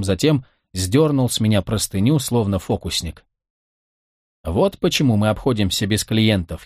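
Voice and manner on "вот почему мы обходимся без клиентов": usual speaking voice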